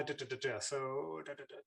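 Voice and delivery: soft filler syllables while searching; absent-minded scanning. Real, not acted. Teacher hunting through audience questions, absent-minded and soft. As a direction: mumble placeholders under your voice as you look.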